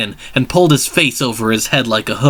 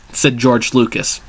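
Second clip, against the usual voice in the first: usual speaking voice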